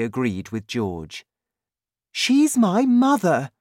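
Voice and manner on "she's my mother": high, loud woman's voice; indignant high-voiced wife; protesting mother. A narrator's reading. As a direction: protest firmly, pitched well up. Wife argues with husband.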